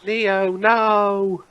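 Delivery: high, drawn-out lament at no extra volume; mock dismay. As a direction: plead after a fallen hero, hammed up. Mock cry to fallen Neo.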